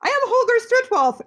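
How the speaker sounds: a hint of a friendly German scholar greeting her; sweet German man. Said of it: "very sweet German man comes up to me".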